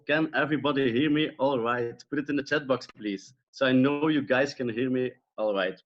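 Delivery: normal talking voice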